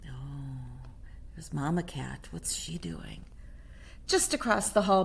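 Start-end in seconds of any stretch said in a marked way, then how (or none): 0.1-3.2 low hushed whisper. Real, not acted. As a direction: whisper the question. Hushed aside about picture.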